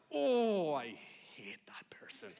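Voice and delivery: seethe with mock envy, dropping to a hush; exclaim, then whisper the grudge; acted envious loathing. Acted envy seeing them drive by.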